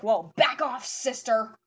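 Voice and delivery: flustered tough guy. Acted. Flustered character warns off the girl hitting on him, mock tough. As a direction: push it out loud, covering the fluster. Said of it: "trying to keep his tough guy persona".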